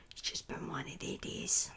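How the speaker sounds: whispered aside to herself; a weary whispered mutter. Real, not acted. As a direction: breathe it out as a tired aside. Muttered aside after misreading.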